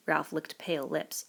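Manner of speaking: usual speaking voice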